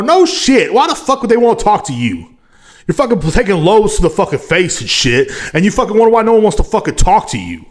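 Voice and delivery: high, loud exasperated rant; heated rant. Real, not acted. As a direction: let the outrage boil over. Ranting at the porn star.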